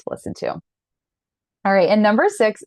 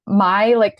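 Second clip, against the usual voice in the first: normal talking voice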